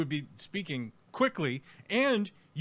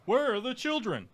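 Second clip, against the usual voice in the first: mock reporter; 8 semitones higher than usual. Streamer mimicking the reporter's nagging question.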